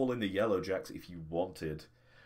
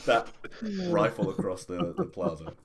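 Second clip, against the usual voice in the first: loud, high, laughing through the words; amused, breaking into laughter; 6 semitones higher, 8 dB louder than usual. Real, not acted. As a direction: crack up while painting the absurd scene. GM cracks up describing comic scene.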